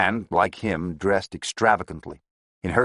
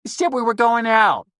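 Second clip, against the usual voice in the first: high whining Bronx moll; whiny schoolgirl voice; 8 dB louder than usual. A narrator's reading. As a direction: whine like a sulky schoolgirl. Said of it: "whining voice of a schoolgirl".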